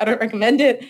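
said through a laugh. Real, not acted. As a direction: chuckle while saying it. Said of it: "that's why I'm like".

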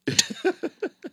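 squeaky rising laugh; high squeal laugh. Real, not acted. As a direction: burst into a high giggle.

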